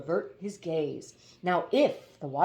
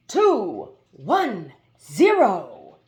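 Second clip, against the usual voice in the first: announcing hoopoe; 9 dB louder, much slower than usual. Acted. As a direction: call it out like an autopilot. Countdown to splashdown.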